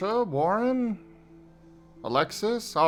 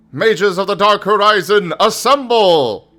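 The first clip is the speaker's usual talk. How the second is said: shouting a summons; 9 semitones higher, 15 dB louder than usual. Acted. Calling the mages to assemble.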